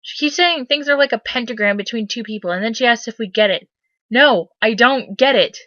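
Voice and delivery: usual speaking voice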